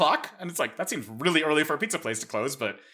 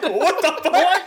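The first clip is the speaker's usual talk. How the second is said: higher, slow, laughing disbelief; stunned disbelief; 12 semitones higher, 5 dB louder, much slower than usual. Retold or imitated. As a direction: blurt it out, half laughing in shock. Retold baffling call center exchange.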